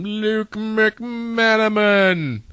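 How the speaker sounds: slow, booming announcer read. Acted. Dramatic name announcement.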